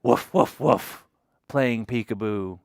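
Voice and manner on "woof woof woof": short, punchy imitated barks; dog woof. Asking children who's hiding in the barn, dog woof. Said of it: "Who's saying woof".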